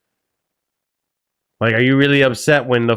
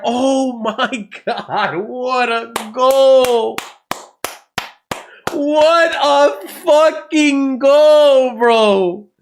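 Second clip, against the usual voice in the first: goal celebration; 11 semitones higher, much slower than usual. Real, not acted. Streamer watching a goal go in, yelling in delight. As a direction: scream it out over a great goal.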